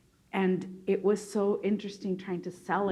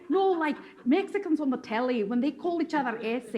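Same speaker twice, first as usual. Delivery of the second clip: high, animated mock Scottish accent; 6 semitones higher, 4 dB louder, slower than usual. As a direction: explain insistently in a comic Scottish accent. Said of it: "a Scottish accent".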